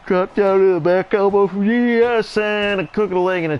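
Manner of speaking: words stretched high and loud through a yawn; yawning while talking. Real, not acted. Commentator yawning through the play-by-play call. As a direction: call the move mid-yawn. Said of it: "Sorry, I was yawning there".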